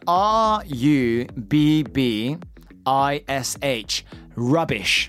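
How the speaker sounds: slow, deliberate dictation of each letter; slow spelling out. Shown as a demonstration. Host spells the word for listeners, slowly and deliberately. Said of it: "皆さんよく聞いてくださいよ".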